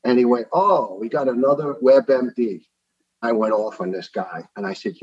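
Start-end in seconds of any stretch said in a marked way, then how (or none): 0.5-2.7 slow, higher sarcastic doctor imitation; mocking dismissal. Doctor dismisses patient's blood cancer claim, mocking. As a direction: swoop up on a drawn-out mocking "oh".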